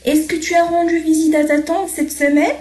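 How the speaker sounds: rising question tune; question intonation. Shown as a demonstration. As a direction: voice rising to a questioning lift on "semaine". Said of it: "غيرت نبرة صوتي".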